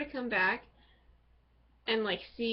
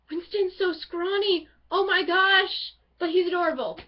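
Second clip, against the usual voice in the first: excited gushing; 7 semitones higher, 6 dB louder than usual. Real, not acted. Gushing about favourite character.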